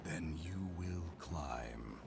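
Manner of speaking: low, very slow menace; booming monster voice. Acted. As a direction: pronounce doom slowly. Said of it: "gives another booming laugh".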